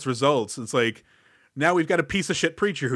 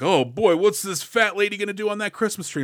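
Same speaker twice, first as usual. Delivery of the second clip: higher, mock-jolly jeer; mocking 1972 attitude; 7 semitones higher, 3 dB louder than usual. Mocking how 1972 film treats her weight, higher jolly jeer. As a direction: sneer like an old-time joker. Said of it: "it was like".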